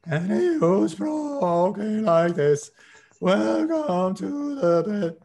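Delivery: high, slow, quavering mock voice; imitating a scared Stoltenberg. Mocking the NATO chief's nervous press conference, high and slow. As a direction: mimic a frightened official stammering at the podium. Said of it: "That is not how he speaks normally".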